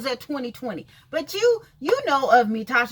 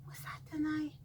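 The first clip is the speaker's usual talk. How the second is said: a breathy unvoiced aside, half to herself; hushed self-question; whispered aside; 16 dB quieter than usual. Real, not acted. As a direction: whisper it to yourself, unsure.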